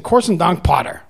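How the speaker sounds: rough, hard American accent on "Korsendank Pater". Shown as a demonstration. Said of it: "like, hard American accent".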